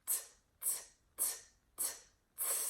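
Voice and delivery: "T, t, t, t, tss" four taps then long hiss; four sharp t's then an explosive s; pop tire sound. Shown as a demonstration. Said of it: "We're gonna make the sound of a pop tire".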